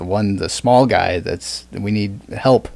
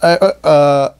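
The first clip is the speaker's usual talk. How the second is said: ordinary talk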